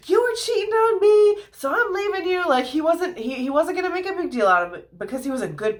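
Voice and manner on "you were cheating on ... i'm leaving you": mock accusation. Joseph hypothetically accusing Mary in public, mock angry outburst. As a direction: play the betrayed boyfriend making a scene. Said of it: "he wasn't gonna be like".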